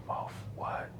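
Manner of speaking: a sudden, startled whisper in the dark. Retold or imitated. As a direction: whisper it as if just woken and alarmed. Wife woken at night, startled.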